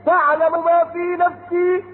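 slow chanted verse; Quran recitation. Acted. Preacher reciting Noah's words to his people, chanted. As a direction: intone it like a reciter.

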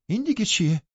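sharp high exclamation from Catherine; said aloud. Acted. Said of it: "با صدای بلند گفت". (با صدای بلند و زیر)

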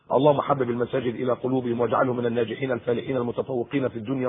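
preacher ending his sermon with a hushed prayer; closing prayer. Acted. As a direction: pray quietly over the children.

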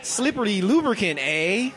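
slow mock-Canadian voice; Canadian accent. Contestant reading a card in a Canadian accent. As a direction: read it slowly as a comic Canadian. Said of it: "It's gonna be Canadian".

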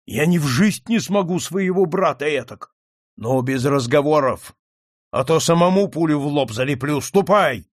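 loud menacing threat; angry threat. Acted. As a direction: threaten him, raise the voice. Count threatens the young man.

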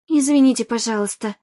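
ordinary delivery

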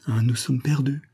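plain reading voice

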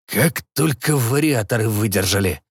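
hoarse pilot voice. Acted. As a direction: rasp it out. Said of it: "хрипло сообщил пилот".

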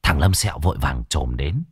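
ordinary delivery

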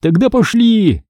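high-pitched rallying order; commanding. A narrator's reading. Chick ordering the recovered group to move, commanding. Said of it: "скомандовал Цыпа".

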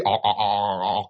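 low glugging noise; soft, low "ọt ọt" gurgles; imitated pouring gurgle. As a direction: mimic liquor glugging into a glass. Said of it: "nghe cái tiếng rượu nó rớt".

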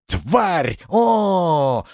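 furious yell. A narrator's reading. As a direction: yell it as you charge. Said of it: "с воплем".

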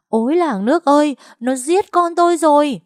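high, loud panicked wail; desperate cry for help. A narrator's reading. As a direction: scream for neighbours to save your son. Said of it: "tiếng kêu cứu của bà Luyến". (kêu cứu thảm thiết)

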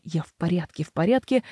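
quick, hoarse reassurance. Acted. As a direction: rasp it out fast. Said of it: "её сорванный голос и быстрое". (сорванный голос)

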